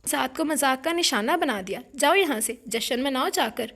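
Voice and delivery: angry outburst. Acted. Betrayed bride ordering her sister out, loud angry outburst. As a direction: throw her sister out of the room.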